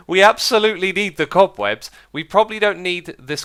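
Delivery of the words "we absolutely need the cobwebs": louder, higher, leaning on absolutely; insist, punching absolutely; absolutely stressed high and loud; emphatic. Real, not acted. Reversing himself emphatically.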